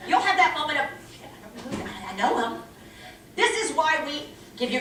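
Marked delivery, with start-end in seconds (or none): 0.9-2.6 confused murmur. Acted. Blanking on a name.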